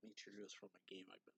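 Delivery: muttered aside. Real, not acted. Storyteller correcting a mixed-up character name, muttered aside. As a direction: drop to a quick, hushed aside. Aside to self while recalling names.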